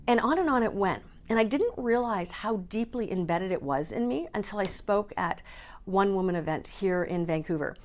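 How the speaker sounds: normal talking voice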